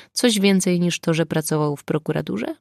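usual speaking voice

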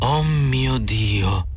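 slow, awed gasp of surprise under the breath; hushed exclamation. A narrator's reading. Wife seeing the goat in the cage, hushed awe. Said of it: "esclamò sottovoce Iran".

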